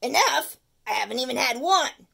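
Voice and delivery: loud, pushy candy craver; demanding tooth. Acted. The tooth demanding peanut butter cups, loud. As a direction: bark for candy like a greedy monster.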